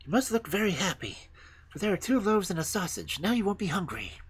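put-on high, brisk girl's voice; high girlish reassurance; bossy robber girl. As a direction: cheer her up firmly, pitched high.

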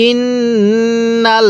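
ইন্নাল্লাযীনা intoned very slowly, the nasal held with ghunnah; teacher modelling ইন্নাল্লাযীনা in tajweed; slow Quranic recitation. Shown as a demonstration. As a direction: chant ইন্নাল্লাযীনা slowly, holding the double n. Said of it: "পড়ুন।".

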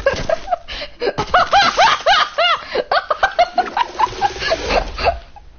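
high, loud burst of laughter. Real, not acted. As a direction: cackle hard at the joke. Friend laughs at clip.

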